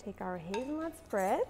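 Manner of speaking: normal talking voice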